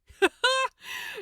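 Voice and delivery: high loud scornful burst of laughter; mocking laugh. Gabriel laughs at Crowley's theory, loud and scornful. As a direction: laugh at his naivety. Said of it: "Gabriel laughed".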